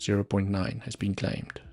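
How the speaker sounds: ordinary talk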